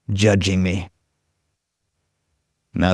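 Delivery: ordinary talk, nothing marked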